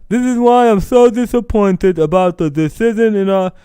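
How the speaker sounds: high mocking official read-out; mock Rob Manfred voice. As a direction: parody a stuffy commissioner being sincere. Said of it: "the actual voice of Rob Manfred".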